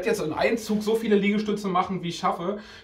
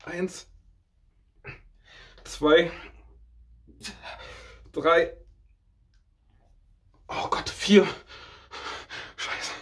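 straining through push ups, counting; counting reps under effort; doing push ups; 4 semitones higher, much slower than usual. Real, not acted. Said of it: "wie unglaublich anstrengend das ist".

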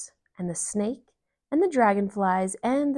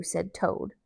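plain reading voice

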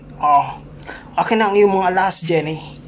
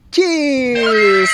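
high, loud toast; cheers shout; 6 semitones higher, 3 dB louder than usual. Real, not acted. Toasting everyone at the party, loud shout. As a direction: raise the glass and call it out.

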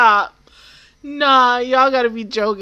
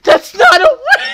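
high, loud yell of disbelief; outraged shout; 10 semitones higher, 10 dB louder than usual. Real, not acted. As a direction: yell it, scandalized. Outraged reaction to show.

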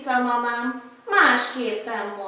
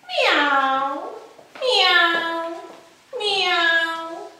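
kitten mewing; 5 semitones higher, much slower than usual. Acted. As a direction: mew for the mother cat. Kitten calls mother.

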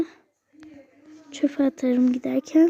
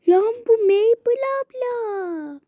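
put-on slow, higher kid's voice pointing someone out; a kid spotting a celebrity, drawn out; little boy gasping at a famous vlogger; excited kid voice; 5 semitones higher, slower than usual. Child spots the vlogger, acted.

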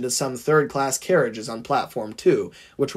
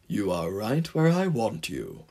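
low, controlled menace; insisting; 4 semitones lower, 4 dB quieter than usual. A narrator's reading. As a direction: insist in a lowered, smug tone. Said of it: "insisted the Diesel".

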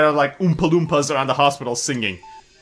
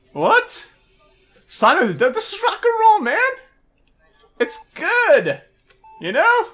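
pitched way up, outraged defense of rock and roll; indignant high outburst. Real, not acted. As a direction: squeal it out, offended that the priest objects.

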